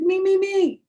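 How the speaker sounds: high, loud repeated shout; eager kid shouting. Acted. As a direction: yell me me me to get picked. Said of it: "I shouted".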